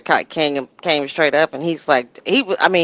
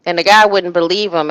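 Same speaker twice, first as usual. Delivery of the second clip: usual speaking voice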